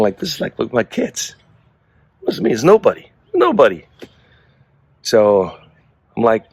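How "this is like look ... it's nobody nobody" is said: high, rising mock-innocent protest; cat's innocent denial. The cat insisting to its owner that nobody did it, in a high mock-innocent voice. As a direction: play the cat swearing it wasn't him, pitch way up. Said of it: "I'm, like, thinking".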